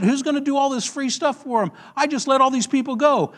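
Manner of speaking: high-pitched indignant grumbling; mad, upset. Retold or imitated. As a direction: play the king fuming over his loss. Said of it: "So he's all mad".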